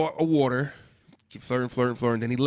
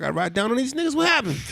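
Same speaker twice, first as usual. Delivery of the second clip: rising higher, ready to ride; fired-up; 10 semitones higher than usual. Retold or imitated. Telling the gas station friend he'd ride for her, excited high voice. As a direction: pitch jumps up, eager to defend her. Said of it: "I'm like".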